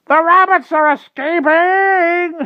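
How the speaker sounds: very high, drawn-out shriek; shrieking crow. A narrator's reading. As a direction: screech it slowly at full volume. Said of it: "shrieked the crow again".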